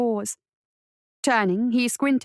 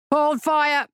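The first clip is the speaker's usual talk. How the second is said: raised, higher-pitched order to a crowd; commanding shout; 5 semitones higher than usual. A narrator's reading. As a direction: call it out as the caravan master. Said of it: "the master shouted".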